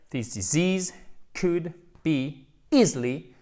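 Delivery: slow model sentence, easily drawn out; slow careful repeat. Shown as a demonstration. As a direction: repeat it slowly for the class. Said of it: "Je répète".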